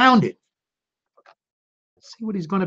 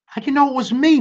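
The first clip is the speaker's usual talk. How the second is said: high whiny turkey voice, pitched up; turkey moaning; 10 semitones higher, faster than usual. Acted. Turkey whining to Cow, pitched high. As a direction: moan it like a caught-out bird. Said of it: "moaned Turkey".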